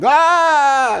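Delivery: high, loud "Ah!"; loud mock battle cry; battle yell. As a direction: yell it out like a charge into a fight. Acting out punching cultists.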